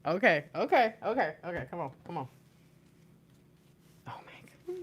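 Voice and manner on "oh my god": high whispered gasp of awe; awed whisper. Real, not acted. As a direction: whisper it, stunned. Awed reaction to the cards.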